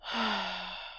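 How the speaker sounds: soft, long sigh; audible exhale. Shown as a demonstration. Connecting you with heavens and earth, soft audible exhale. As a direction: release the breath slowly and softly. Modelling deep breathing.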